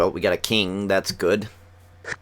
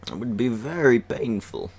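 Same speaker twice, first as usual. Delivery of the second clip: a light Bane impression, slightly higher; 4 semitones higher than usual. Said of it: "Do my Bane voice".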